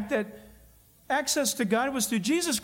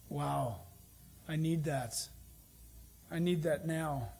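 much softer, lower, half to himself; hushed awe; 7 semitones lower, 8 dB quieter than usual. Real, not acted. Preacher moved by the word for peace, softly in awe. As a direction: let the wonder drop to a murmur.

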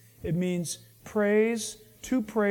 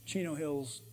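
soft, lower, hesitant little answer; sheepish mumble; 7 semitones lower, 10 dB quieter, slower than usual. Acted. The outbragged listener answers Paul, sheepish and soft. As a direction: mumble it like you know you've lost.

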